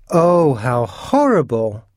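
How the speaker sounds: drawn-out, horrified exclamation; slow, theatrical horror at the sight. A narrator's reading. Mrs Otis reacts to blood on the floor, horrified.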